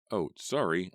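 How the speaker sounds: ordinary delivery, nothing marked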